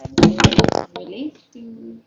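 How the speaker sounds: drawn-out, loud call to viewers. Real, not acted. Host calling out to her livestream viewers, loud and slow. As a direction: stretch it out, calling to the chat.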